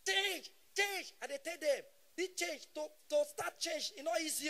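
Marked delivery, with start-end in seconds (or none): 0.1-1.1 mock crowd shout. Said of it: "When they shout".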